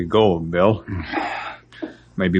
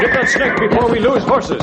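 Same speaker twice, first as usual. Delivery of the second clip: urgent shout. Acted. Snake threatens the horses.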